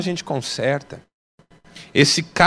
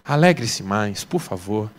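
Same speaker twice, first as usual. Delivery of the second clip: gentle appeal; 7 semitones lower, 5 dB quieter than usual. Real, not acted.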